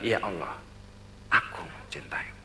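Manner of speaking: a soft devoted whisper; whispered prayer. Retold or imitated. The traveler whispering to God at every sight, soft whisper. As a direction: whisper it tenderly, just for God.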